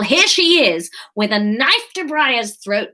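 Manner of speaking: ordinary delivery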